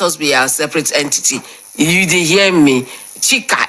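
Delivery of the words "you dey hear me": usual speaking voice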